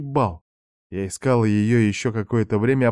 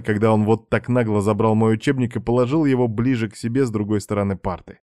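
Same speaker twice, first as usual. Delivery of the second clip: ordinary delivery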